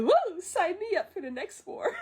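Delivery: high, giggly whoop of eagerness; excited grandma. Retold or imitated. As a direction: play an eager grandma, laughing through it. Excited grandma acted in film retelling.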